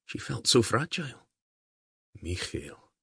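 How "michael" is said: "Michael" said the Gaelic way as mee-khil, with a throaty ch; Gaelic pronunciation. A narrator's reading. Diarmaid names the girl in Gaelic, "Michael" with a throaty ch. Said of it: "he said in Gaelic".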